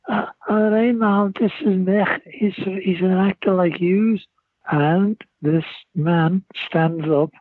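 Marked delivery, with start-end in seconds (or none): 0.1-4.2 put-on higher Scouse girl voice; higher-pitched Scouse accent; Scouse girl introducing. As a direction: introduce him to mum in broad Liverpool. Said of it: "she says".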